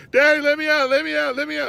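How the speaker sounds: high, loud mock child's scream; imitating screaming toddler. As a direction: wail it like a little girl locked in timeout. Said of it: "She's screaming and beating on the door".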